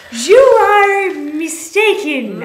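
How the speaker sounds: slow, loud, hammed-up French accent; mock French accent. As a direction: put on a bad French accent. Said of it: "I said in my best French accent".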